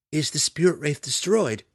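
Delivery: high, loud distressed question; sincere distress. A narrator's reading. Daughter asking her mother about the wraith, sincere distress. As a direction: cry it out in alarm. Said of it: "Maya cried in sincere distress".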